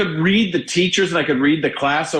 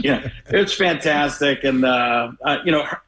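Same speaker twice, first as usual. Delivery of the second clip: ordinary talk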